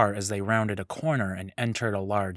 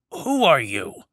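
put-on old Turaga voice; 5 semitones higher, 6 dB louder, slower than usual. Waking prisoner questions stranger.

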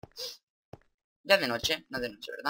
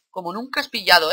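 raised, high-pitched battle taunt; loud taunt; 5 semitones higher, 9 dB louder than usual. Real, not acted. Player taunting whatever is coming for him, loud and cocky. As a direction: throw it loudly at the attacker.

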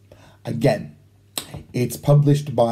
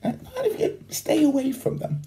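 laughing; 12 semitones higher, 6 dB quieter, faster than usual. Real, not acted. Laugh heard on line.

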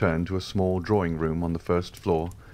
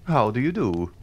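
a light mocking how-do-you-do, higher; half-jesting; 6 semitones higher, 3 dB louder than usual. A narrator's reading. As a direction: tease him a little as you greet. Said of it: "with his half-jesting tone".

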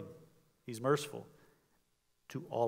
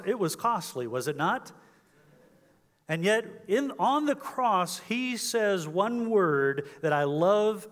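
raised, louder, slowing preacher's climax; fervent preaching; 5 semitones higher, 7 dB louder than usual. Acted. Preacher building to full force.

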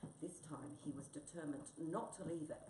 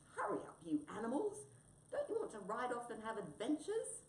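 raised, louder eager call; 6 semitones higher, 4 dB louder than usual. Acted. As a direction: summon friends to an adventure. Cat calls friends to bike.

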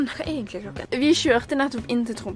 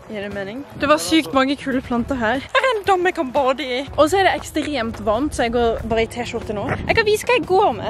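ordinary delivery

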